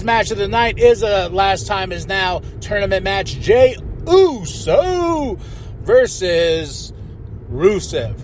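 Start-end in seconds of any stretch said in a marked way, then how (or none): 3.4-8.2 stretched announcer call on the names; drawn-out hype announcement; ring announcer. Acted. Match announcement.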